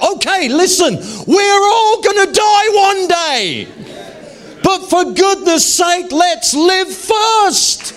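high, loud and slow, fed up; exasperated outburst. Retold or imitated. Preacher to the congregation, voicing a high, loud, slow, fed-up plea. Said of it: "you're like".

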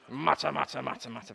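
low, grumbling mutter; grumpy mutter. Shown as a demonstration. Preacher showing the grumpy Pharisee side how to mutter, low grumble. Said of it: "you go".

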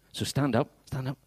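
ordinary talk, nothing marked

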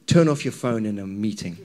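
ordinary delivery

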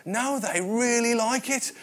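reassuring local barman, voice lifted; barman's reply. Retold or imitated. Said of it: "the barman looks at me and says".

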